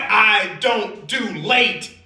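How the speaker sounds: "I don't do late" in loud, high, slow words; "I don't do late" drawn out word by word; emphatic refusal. Acted. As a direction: space out each word of "I don't do late", loudly. Acted driver's emphatic refusal.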